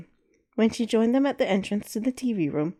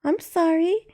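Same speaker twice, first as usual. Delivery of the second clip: put-on little girl voice; 10 semitones higher, 3 dB louder, slower than usual. Scolded kid apologizes.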